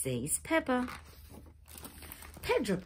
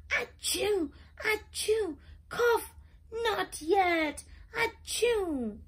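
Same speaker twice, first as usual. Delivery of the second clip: high, loud mock sneezes and coughs; high hammed-up sneezing fit; 13 semitones higher, 3 dB louder, slower than usual. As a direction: sneeze and cough through the words. Sick pony sneezing fit.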